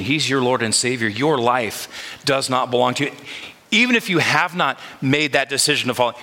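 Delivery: ordinary delivery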